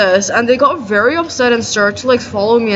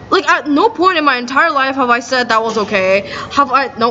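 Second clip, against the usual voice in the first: usual speaking voice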